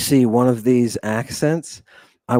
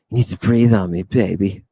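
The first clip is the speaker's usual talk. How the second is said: voiced breathing mark; 6 semitones lower than usual. A breathing mark asking to be breathed on, lightly acted low voice. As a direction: drop lower for a mock-seductive line. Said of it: "It says".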